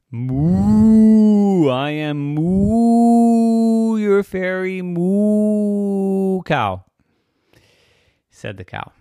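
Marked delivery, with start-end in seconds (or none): 0.1-6.8 loud, long drawn-out mooing speech; high, booming cow voice; mooing fairy cow. Acted. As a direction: stretch the words into big moos. Fairy cow introduces itself in moos.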